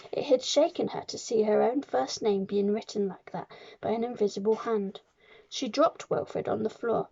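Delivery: plain reading voice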